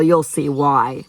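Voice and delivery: usual speaking voice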